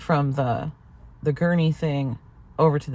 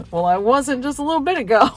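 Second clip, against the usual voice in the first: laughing through it, high and louder; 10 semitones higher, 4 dB louder than usual. Real, not acted. Wife telling viewers her comeback, laughing.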